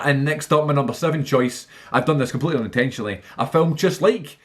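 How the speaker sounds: ordinary talk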